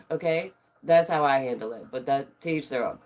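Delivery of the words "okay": usual speaking voice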